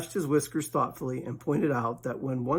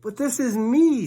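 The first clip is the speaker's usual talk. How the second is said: high, louder surprised bear; 10 semitones higher, 6 dB louder than usual. Acted. Pooh surprised at Rabbit's reply, high and louder. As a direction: protest it with surprise. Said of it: "very much surprised".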